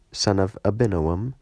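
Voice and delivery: ordinary delivery, nothing marked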